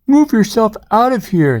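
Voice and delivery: high, emphatic ordering-off; bossy big snake. A narrator's reading. Big snake chases pig from swamp, bossy. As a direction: order them away, pitched well up. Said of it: "says a big snake".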